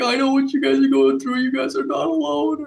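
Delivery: very high, put-on weepy voice; mock tearful singer. Retelling the singer crying to the crowd, high weepy voice. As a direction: squeak it out like a singer choking up. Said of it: "started to break down and crying".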